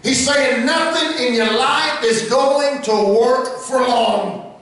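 high, chanted, sung-out preaching over the organ; half-sing each line to the church, slow and rising; whooping preaching. Acted. Preacher to the congregation about Christ's sacrifice, sung whooping preaching.

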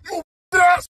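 can't believe what's in the box; outraged disbelief. Real, not acted.

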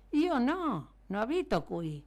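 mild storybook shout, leaping high at the start; shouted denial. A narrator's reading. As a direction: call out the denial, high then settling. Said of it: "gridò il signor Veneranda".

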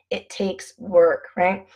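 ordinary delivery

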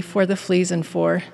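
usual speaking voice

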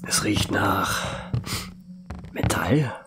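soft, tense whisper. Acted. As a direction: dread the answer as you say it. Creeping through dark house. (angespanntes Flüstern)

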